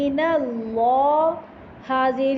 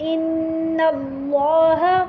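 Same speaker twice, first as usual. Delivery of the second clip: slow raised recitation voice; 3 semitones higher than usual. Shown as a demonstration. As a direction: recite each word slowly, lifted. Final model recitation.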